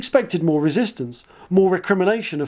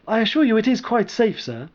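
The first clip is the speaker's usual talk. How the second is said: slightly higher, dutiful junior officer; a hint of an eager young lieutenant; lightly voiced young lieutenant; 7 semitones higher than usual. Sark reassuring commander.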